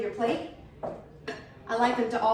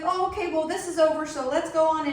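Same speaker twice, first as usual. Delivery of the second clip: higher, louder flustered brush-off; awkward husband; 6 semitones higher, 4 dB louder than usual. Retold or imitated. Husband cutting the flirting short, higher flustered voice. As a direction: sound caught and eager to escape. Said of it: "and he's like".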